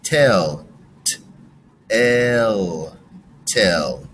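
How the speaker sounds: slowly segment tell into sounds, then say tell whole; sounding out tell. Shown as a demonstration. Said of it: "let's do this again".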